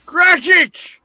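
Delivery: high loud call. Acted. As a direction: summon him sharply. Scrooge calls clerk.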